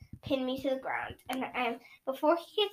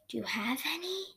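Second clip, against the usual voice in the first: whispering tree; 8 dB quieter than usual. Acted. Wounded Hearth Star whispering to the children. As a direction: whisper it wearily. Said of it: "whispering vibe".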